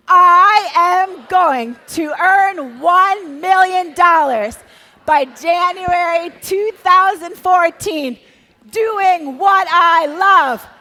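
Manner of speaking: loud, high and very slow declaration; with all the fervor. Shown as a demonstration. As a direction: proclaim it with full might, slowly. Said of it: "say this with all the fervor".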